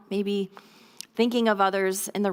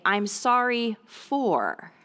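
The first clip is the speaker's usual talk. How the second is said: I'm sorry for, drawn out slowly; slow modelled apology; much slower than usual. Shown as a demonstration. Parent teaching kids an apology script, slow and clear. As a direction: spell out the phrase slowly for parents. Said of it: "have them say".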